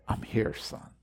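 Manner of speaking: whispered, gentle answer; quiet reassurance. Acted. The Lord whispering back to his searching son. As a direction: breathe it softly like a father.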